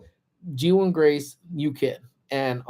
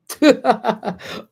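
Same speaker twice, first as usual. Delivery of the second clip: short high giggle; high laughing burst; 9 semitones higher, 4 dB louder than usual. Real, not acted. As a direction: let a giggle escape. Laughing at chat.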